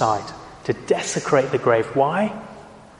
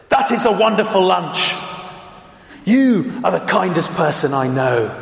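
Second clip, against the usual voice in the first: angry voice; 3 semitones higher than usual. Shown as a demonstration. Speaker shows words that clash with an angry voice, mock angry. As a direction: snap these nice words as if furious. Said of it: "don't sound right in an angry voice".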